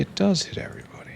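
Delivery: high, fast breathy whisper; breathy high aside. Real, not acted. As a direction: toss it off in a quick, high whisper. Gloating over a working upgrade.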